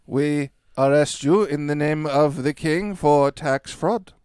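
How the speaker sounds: loud, slowed-down proclamation; loud arrest. Acted. The guard arresting the mayor, loud and formal. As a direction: declare it with authority.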